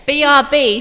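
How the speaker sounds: loud, spelled out BRB; loud announcement. Acted. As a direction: call it out. Announcing break to listeners.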